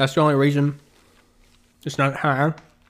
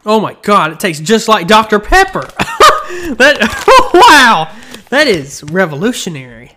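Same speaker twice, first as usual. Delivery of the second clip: amazed; 6 semitones higher, 5 dB louder than usual. Real, not acted. Tasting the Doctor Pepper candy.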